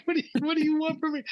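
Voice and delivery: high, fast mock exasperation; retold exasperation. Retold exasperated reply to pilot.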